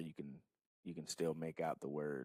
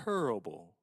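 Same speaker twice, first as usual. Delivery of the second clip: Charles Barkley impression; 2 semitones higher, 4 dB louder, slower than usual. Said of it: "as Charles Barkley would say".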